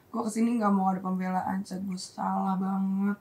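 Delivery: soft, sunken retold apology; subdued apology. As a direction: imitate a low, hushed admission. Re-enacts friend's subdued apology.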